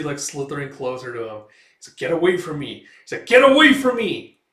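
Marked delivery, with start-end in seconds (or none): 3.3-4.4 much higher, much louder yell; acted shout. Guts yells at the slithering demon, loud and high. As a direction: scream it at the creature in alarm. Prisoner repelling creature, escalates.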